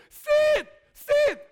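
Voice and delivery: shouted sit sit, voice strained; loud, high sit, sit yelled at a dog; loud command. Retold or imitated. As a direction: bellow at the dog in a panic. Yelling commands at scary dogs.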